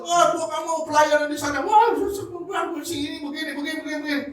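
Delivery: high-pitched mock griping; mock complaining. Counselee refusing to serve there, mock complaining. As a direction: rattle off grievances in a hammed-up high voice.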